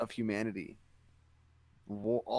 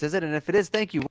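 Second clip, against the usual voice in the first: ordinary talk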